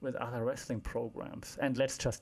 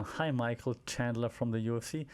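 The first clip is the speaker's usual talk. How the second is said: ordinary delivery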